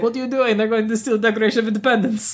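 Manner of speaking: put-on high-pitched German-accented alarm. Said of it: "That's not a German accent, but that's how she sounds".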